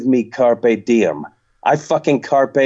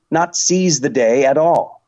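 usual speaking voice

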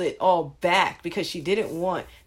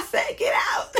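mock yell. She repeats her order to the little girls, mock yelling. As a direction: imitate a mom screeching them out.

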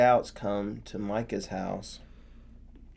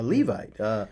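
high, louder coaxing host voice; Micah's invitation; 8 semitones higher, 5 dB louder than usual. Acted. Micah coaxing the Levite to come with him, high and eager. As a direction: lure him in, eager and friendly.

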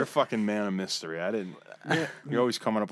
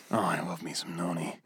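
lightly croaky granny voice; grandma voice; 6 semitones lower, 4 dB quieter than usual. Pretend. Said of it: "Here we go again with the grandma".

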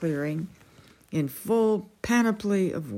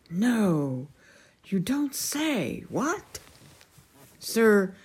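pitched up, slow startled disbelief; startled king; 3 semitones higher, much slower than usual. A narrator's reading. The king hears of the joust, startled and higher. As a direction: squeak out the surprise.

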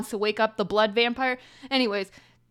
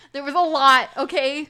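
louder and higher, protesting; defensive outburst; 6 semitones higher, 10 dB louder than usual. Real, not acted. Teased about the long summary, she protests loudly. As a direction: push back hard: "There was a lot, okay?".

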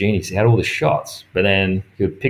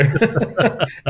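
high laugh; 10 semitones higher than usual. Real, not acted. Laughing at his own story.

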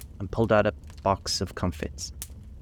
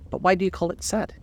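put-on puzzled girl voice; 10 semitones higher than usual. A narrator's reading.

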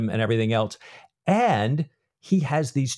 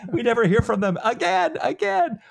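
high-pitched, laughing protest; laughing exclamation; 12 semitones higher than usual. Real, not acted. Co-host lamenting characters who vanish from the book, laughing. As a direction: let the voice climb high on a laugh.

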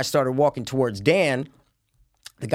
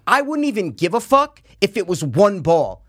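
higher, slowly hammered-out telling-off; indignant tone; 6 semitones higher, 4 dB louder, slower than usual. Retold or imitated. Golfer scolding players who nearly hit him, indignant. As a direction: spell out the offense, word by word. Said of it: "this is the tone I'm saying".

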